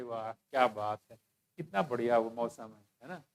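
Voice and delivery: low, soft, dreamy contentment; acted bliss, sunk low and quiet; blissed-out drug user. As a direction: murmur it lazily, lost in euphoria. Acting out blissful user by drain.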